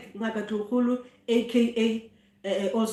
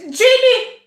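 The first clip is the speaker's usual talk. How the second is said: loud call, pitched much higher; 12 semitones higher, 9 dB louder than usual. Real, not acted. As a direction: shout his name up the stairs. Calling her son across the house.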